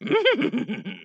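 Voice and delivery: high little sage's giggle; creature chuckle. Acted. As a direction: chuckle like a tiny old master.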